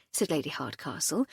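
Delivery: ordinary delivery, nothing marked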